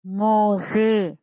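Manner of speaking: मोजे stretched very slowly, long final ए; slow demo word. Shown as a demonstration. Teacher models मोजे for pupils, slow. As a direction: draw मोजे out so the ए is clear. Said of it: "मोजे में आखिर में बड़ी ए की sound".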